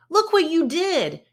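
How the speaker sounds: high, loud angry yell at Fred; shouted. Acted. Brother yelling at Fred over the bent wheel, shouting. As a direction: let him have it, full shout. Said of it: "shouted brother".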